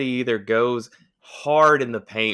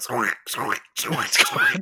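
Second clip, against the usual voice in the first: mock door squeaks; 2 semitones higher, slower than usual. Friend imitating the door opening, squeaking. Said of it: "You just hear, like".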